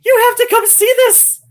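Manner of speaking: high, loud, breathless with laughter; excited shout. Retold or imitated. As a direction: yell it through helpless laughs. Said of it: "he says".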